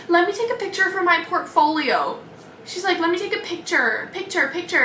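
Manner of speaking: lightly voiced eager student. The student asking to photograph the nails, mock chirpy.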